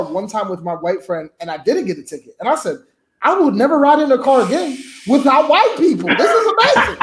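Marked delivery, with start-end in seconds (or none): 3.2-7.0 high, loud comic amazement with laughter; amazed delight. Acted. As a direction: exclaim like you've just discovered a miracle. Acted amazement at escaping a ticket.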